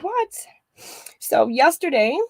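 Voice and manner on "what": high startled what; surprised. Retold or imitated. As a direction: thrown off, sudden high question. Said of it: "I'm like".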